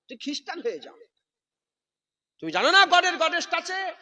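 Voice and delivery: high loud scornful jab; sarcastic shouted taunt; mocking rebuke. Real, not acted. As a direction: scoff at them loudly. Preacher mocking modern speakers.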